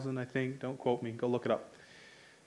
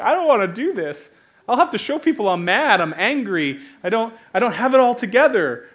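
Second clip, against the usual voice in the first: higher, louder mock complaint, hammed-up; mock frustrated outburst; 7 semitones higher, 9 dB louder than usual. Preacher voicing frustrated churchgoers, mock-angry loud. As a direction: act out a fed-up believer griping loud.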